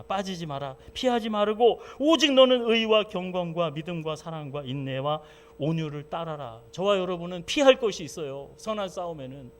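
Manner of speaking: ordinary delivery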